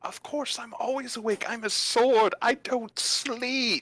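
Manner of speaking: high-pitched indignant sword, last word drawn out; eager talking sword. Acted. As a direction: play a keen, never-sleeping sword. GM voices the sword Ned.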